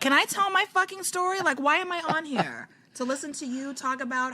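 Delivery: high-pitched, exasperated objection; exasperated, pleading to be heard; indignant protest. Real, not acted. Cuts in, protesting the interruption.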